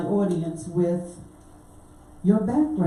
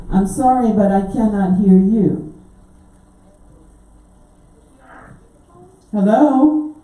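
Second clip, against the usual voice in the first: shouting into a phone; 7 dB louder than usual. Real, not acted. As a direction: raise your voice so the phone carries. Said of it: "It sounds a little distant".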